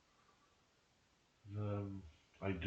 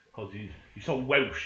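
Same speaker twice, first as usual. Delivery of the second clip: sarcastic mockery; 2 semitones higher, 9 dB louder than usual. Mocking friend's claim of being Welsh.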